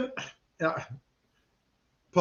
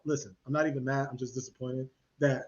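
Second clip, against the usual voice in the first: like a father; 8 semitones lower, 7 dB quieter than usual. Retold or imitated. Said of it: "I spoke like, like a father".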